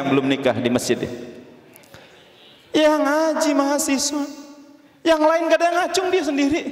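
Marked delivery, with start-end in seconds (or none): 2.7-6.6 high-pitched shout-out from the crowd; excited announcement, pitched way up; calling out. Acted. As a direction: call it out high and eager. Congregation points out the lone bachelor.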